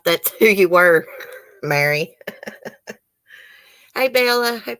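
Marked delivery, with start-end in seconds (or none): 2.3-2.9 soft, voiceless chuckle. Real, not acted. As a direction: laugh quietly to yourself. Amused after naming Mary.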